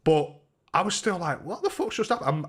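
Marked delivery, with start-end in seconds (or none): 1.4-2.4 higher-pitched retold disbelief; acted bewilderment. Punched man still reeling, mock bewildered. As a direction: replay your confusion, pitched up.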